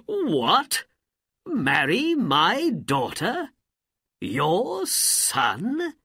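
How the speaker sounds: high, loud, astonished disbelief; can't believe what you heard; incredulous. Acted. Sultan astonished that a poor boy wants the princess.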